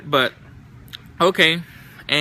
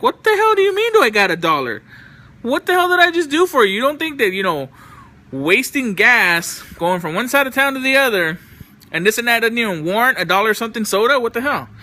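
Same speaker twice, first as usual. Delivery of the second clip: retold angry rant; 6 semitones higher than usual. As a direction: blow up at the friend asking for a dollar. Retelling an outburst at his friend.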